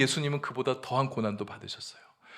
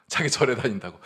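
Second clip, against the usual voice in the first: ordinary talk, nothing marked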